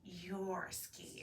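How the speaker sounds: hushed whisper, pinning it on her; whispered tease. Acted. Bear whispering back to Bunny, a sly whispered tease. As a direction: breathe it like a sly accusation.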